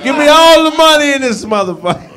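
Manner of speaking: stern robber voice, laughing through it; bank robber. Pretend. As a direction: bark orders at the bank teller. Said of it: "rob this motherfucker with a headlock and a stern voice".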